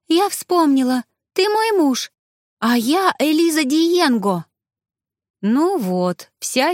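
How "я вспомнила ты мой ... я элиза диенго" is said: high-pitched, elated outburst; excited recognition. A narrator's reading. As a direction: burst out with rising delight. Ghost joyfully regains her memory.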